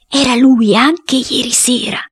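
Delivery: slow, high, loud declaration; medium's pronouncement. Acted. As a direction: proclaim the spirit's answer slowly and emphatically. Cuoco riferisce il responso. (responso enfatico, lento e acuto)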